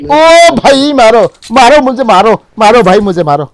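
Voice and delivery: loud excited yell for help; cover me shout. Real, not acted. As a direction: shout it mid-fight, pitch up. Shouting in a gunfight.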